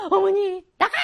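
high, loud mock shout; hammed-up shout. Shown as a demonstration. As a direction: overact the climax. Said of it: "막 이렇게". (과장된 외침)

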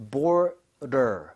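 "border" in two slow parts, bor-der, the D near ㄹ; soft D like ㄹ. Shown as a demonstration. Teacher breaks down the sound, soft flapped D in "border". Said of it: "중간에 리을 비슷하죠".

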